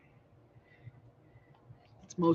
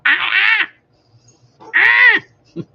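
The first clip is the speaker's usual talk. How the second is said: three sharp high, loud "ah" cries in a row; sound effect cries; 10 dB louder, much slower than usual. Shown as a demonstration. Showing her sound effects to the viewers, with sharp, high, loud "ah" cries. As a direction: belt out each "ah" like a cartoon sound effect. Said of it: "like when I go".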